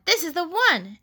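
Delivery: high, eager announcement; excited declaration. A narrator's reading. Froggy finding his big pumpkin, excited high declaration. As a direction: proclaim it with jumping pitch.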